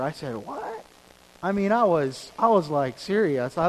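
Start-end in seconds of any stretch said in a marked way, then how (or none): 0.4-0.8 high, astonished squeak; shocked what. Retold or imitated. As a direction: react in utter disbelief. Shocked reply to Sean.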